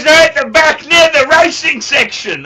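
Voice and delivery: loud, high-pitched shout; dad shouting. Retold or imitated. Son imitates father's advice, loud shouting. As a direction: yell it as a gruff order. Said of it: "He goes".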